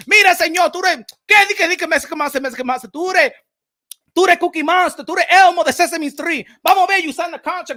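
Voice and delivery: loud, fired-up Spanish hype call; hype man shout. Pretend. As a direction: pump up the crowd like a fight-night hype man. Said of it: "to be their hype man".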